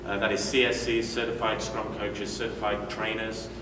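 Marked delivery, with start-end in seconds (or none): none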